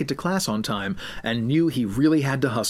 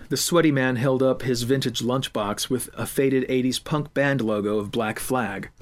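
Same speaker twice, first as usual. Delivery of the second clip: ordinary delivery, nothing marked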